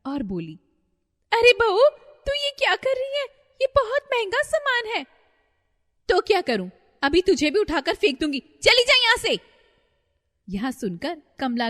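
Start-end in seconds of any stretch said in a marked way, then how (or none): 1.3-5.1 much higher, louder panicked mother-in-law. Acted. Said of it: "बहुत ज्यादा घबरा गई". 6.1-9.4 loud, higher snarled threat; threatening shout. Acted. Possessed bride threatens mother-in-law.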